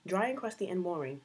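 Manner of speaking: usual speaking voice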